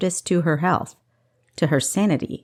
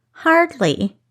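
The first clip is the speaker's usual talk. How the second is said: a higher, dismissive "Hardly"; scoffing; 9 semitones higher, 4 dB louder than usual. A narrator's reading. Thief scoffing at the caged man, higher and dismissive. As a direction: brush him off with scorn. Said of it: "Kessa scoffed".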